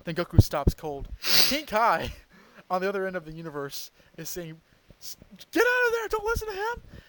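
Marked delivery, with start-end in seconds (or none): 5.0-7.0 much higher, urgent cry of alarm; high panicked warning. Retold or imitated. King Kai frantically warns Goku off, high panicked cry. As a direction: squeak out a frantic warning from far away.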